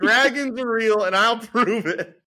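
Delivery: loud, high hammed-up rally cry; mock campaign shout. Running for president, a hammed-up loud, high rally cry. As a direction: proclaim it like a candidate stumping.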